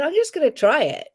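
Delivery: lightly voiced tasting; playful quote. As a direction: try it on a whim. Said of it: "I said".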